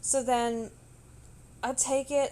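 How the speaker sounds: usual speaking voice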